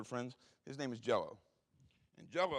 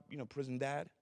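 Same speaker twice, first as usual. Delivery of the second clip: ordinary delivery, nothing marked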